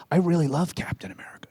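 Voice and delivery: soft quick whisper; whispered kid. Retold or imitated. Child admiring a comic hero, whispered. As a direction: breathe it like a secret fan confession. Kid's voice quoted.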